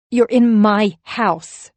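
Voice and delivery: «in» with falling tune; slow, loud insistence. A narrator's reading. Insisting at own door.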